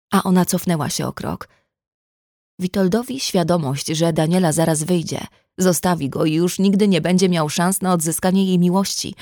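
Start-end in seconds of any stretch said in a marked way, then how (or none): none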